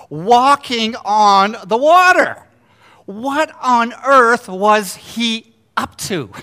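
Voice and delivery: loud amazement. Real, not acted. Preacher marvelling at Jesus walking on the water, loud amazement. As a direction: stretch every word out in disbelief.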